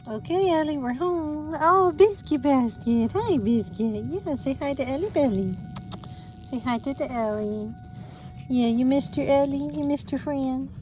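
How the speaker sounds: high, sing-song doggy greeting; sweet pet talk. Real, not acted. Owner bringing her dog home to friends, sweetly coaxing.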